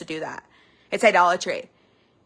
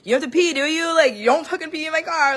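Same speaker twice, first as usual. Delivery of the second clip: retold panicky scolding, higher and a bit louder; mock freak-out at a friend about peeing; higher-pitched panicked nagging; 6 semitones higher, 3 dB louder than usual. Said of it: "like, freaking out".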